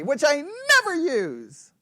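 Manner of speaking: playful sarcastic exaggeration. Shown as a demonstration. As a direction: deny it ironically. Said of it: "to be sarcastic".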